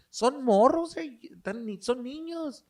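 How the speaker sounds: high, drawn-out mock girl voice; paisita girls. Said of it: "The little paisita girls".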